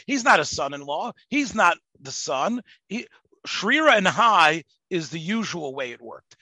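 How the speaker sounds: normal talking voice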